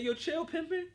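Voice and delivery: high-pitched teasing call; retold calling out. Father calls to his ex on FaceTime, high playful protest. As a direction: pitch it way up, egging her on.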